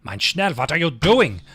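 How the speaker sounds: mock German accent, impatient commands; German boss impression. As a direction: play a German psychopath boss ordering you around in a German accent. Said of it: "like, a fucking German narcissistic psychopath".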